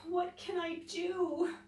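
high, slow, tearful little voice; a frightened rabbit close to tears; sobbing rabbit. Acted. Scared Rabbit asks the others for help, sobbing. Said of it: "sobbed Rabbit".

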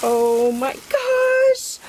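very high, slow, drawn-out squeal; thrilled squeal. Real, not acted. Seeing the lace from Jean, a high thrilled squeal. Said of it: "so, so excited".

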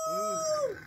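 high howling yelp; wolf howl. Pretend. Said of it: "That was quiet".

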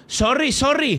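put-on sorry, sorry said high with a thick Spanish accent, sori sori; Spanish-accented English. Streamer replaying his apology to the enemy, in heavy Spanish accent. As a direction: repeat your own sorry in a strong Spanish accent, high-pitched. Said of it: "he dicho sorry con el acento más español de la historia".

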